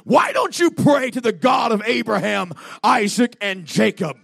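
high, booming preacher's shout; screaming voice. Retold or imitated. Voice to the man with the gun, screaming. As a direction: shout it out as the voice from the light. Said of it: "a voice was screaming".

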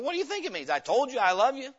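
exasperated comeback, pitch jumping up high; frustrated retort. Acted. Father answers son who doubts his love, exasperated. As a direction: throw it back, irritated and insistent. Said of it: "is he frustrated with her".

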